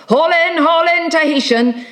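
high, insistent hauling call; called-out order. Acted. Sailor urging Tahitian to haul in, called high.

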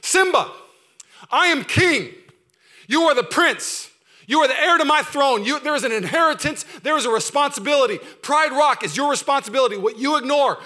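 preached-out royal charge; commanding king. Retold or imitated. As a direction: command him like a king to his heir. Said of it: "He's saying".

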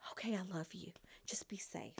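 soft, breathy whispered goodbye; meek goodbye. Retold or imitated. Meek wife whispering goodbye to husband off on a trip. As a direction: barely whisper it, meek and accepting.

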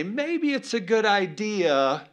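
a hint of a timid, hesitant voice, slow; mock the cautious option, slowly; timid mock suggestion. Pastor mocking the cautious disciples, in a lightly timid voice. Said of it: "I can't even say it".

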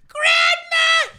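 shrill falsetto shriek of the word; high-pitched scream. Retold or imitated. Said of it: "a high-pitched scream of".

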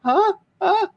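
high puzzled huhs; confused huh. Retold or imitated. Imitating a confused date checking his face, high puzzled huhs. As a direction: ask it like she doesn't follow. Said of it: "is she going".

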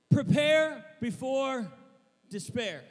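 loud, slow, drawn-out proclamation; emphatic declaration. Real, not acted. Preacher announces the sermon theme, emphatic and loud. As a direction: proclaim the title slowly and forcefully.

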